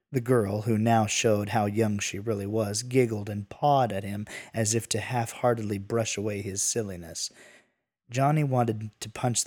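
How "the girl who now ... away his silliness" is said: ordinary delivery, nothing marked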